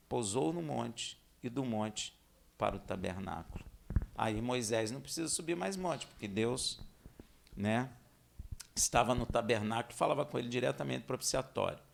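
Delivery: ordinary talk, nothing marked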